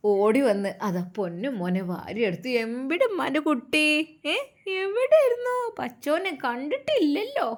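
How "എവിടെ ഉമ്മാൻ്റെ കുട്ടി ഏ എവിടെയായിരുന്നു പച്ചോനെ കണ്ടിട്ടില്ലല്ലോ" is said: put-on high cooing old woman; doting grandmother. A narrator's reading. Grandmother cooing at her grandson. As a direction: coo over the little one.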